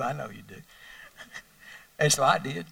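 ordinary talk, nothing marked

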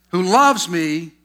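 slow, loud weight on "loves"; emphatic scripture phrase. Real, not acted. Preacher to congregation on the key verse, emphatic. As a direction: stretch out "loves" and let it land.

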